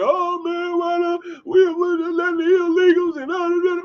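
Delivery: high, whiny mock complaint; exaggerated high-pitched imitation of whiners; mocking complainers. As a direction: mimic their griping in a squeaky whine. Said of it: "So why everybody be up here".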